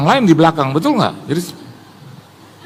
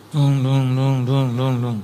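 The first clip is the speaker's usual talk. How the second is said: low, droning repeated syllables; imitated bumpy ride; 5 semitones lower, 3 dB quieter, slower than usual. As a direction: voice the long drive as rhythmic noises. Menirukan perjalanan masuk jauh. (tiruan bunyi perjalanan, rendah berulang)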